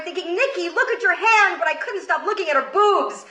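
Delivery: normal talking voice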